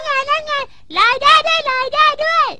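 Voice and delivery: high, loud cry of wonder; excited shout. Acted. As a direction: call out as it floats off. Balloon floating away.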